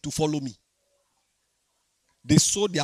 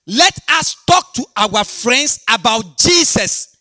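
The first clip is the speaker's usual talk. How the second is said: loud, high, slow preaching; fiery sermon shout; preaching at full force; 8 semitones higher, 9 dB louder, slower than usual. Acted. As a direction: hammer it home to the church. Preaching to congregation.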